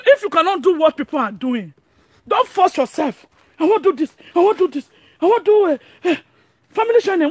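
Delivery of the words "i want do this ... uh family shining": high, mocking whine of a copycat; higher-pitched mock quote; mocking imitation. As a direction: mimic them in a raised, taunting voice. Mocking people who copy others.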